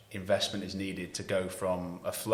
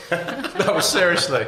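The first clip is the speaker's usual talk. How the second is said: laughing; 10 semitones higher, 10 dB louder, slower than usual. Real, not acted. Laughing at the coconut story.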